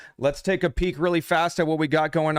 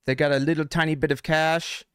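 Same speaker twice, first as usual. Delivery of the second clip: usual speaking voice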